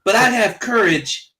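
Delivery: high, eager anime hero voice; overacted anime dub. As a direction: ham it up like an anime schoolboy. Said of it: "interior voice that sounds like an anime character".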